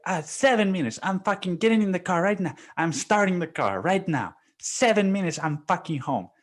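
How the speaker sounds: high, hurried promises; hammed-up frantic excuses; mock frantic boss. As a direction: rattle it off like a caught husband.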